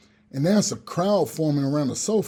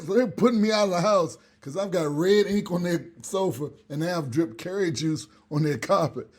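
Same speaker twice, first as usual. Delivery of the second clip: ordinary talk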